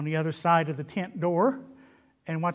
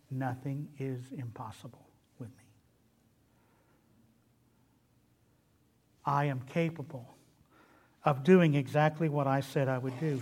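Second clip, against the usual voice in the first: quiet assurance; 4 semitones lower, 3 dB quieter than usual. Retold or imitated. God answering doubting Sarah, soft and sure. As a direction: say it gently, as a calm certainty. God's answer quoted, hushed.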